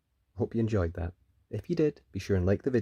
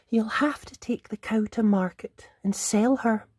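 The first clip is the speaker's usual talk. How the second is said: sad mother; 11 semitones higher than usual. Acted. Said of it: "Jack's mother said sadly".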